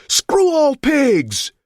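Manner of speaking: slow furious yell. Acted. Crowd heckler yelling.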